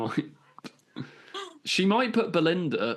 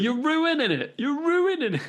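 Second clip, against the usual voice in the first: high, loud mock complaint; teasing protest; 13 semitones higher, 3 dB louder than usual. Real, not acted. Host teasing co-host who is spoiling the outro, loud. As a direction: whine at him for spoiling it.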